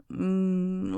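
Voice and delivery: hushed, close-mic ASMR whisper; intimate whisper roleplay. Acted. Miss murmuring to her pet, close whisper. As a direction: breathe every line close to the ear.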